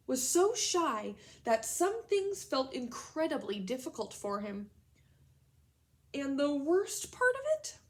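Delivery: slow, loud, expressive storybook reading; dramatic read-aloud to children; story narration. A narrator's reading. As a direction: read it big and slow for the class. Reads the story aloud to class.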